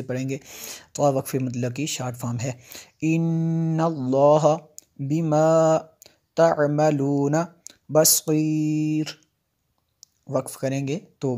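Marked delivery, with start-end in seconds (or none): 3.0-9.1 Quran recitation. Shown as a demonstration. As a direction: chant the verse slowly like a qari. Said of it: "teacher reciting verse". (धीमी, ऊँची तजवीद वाली तिलावत)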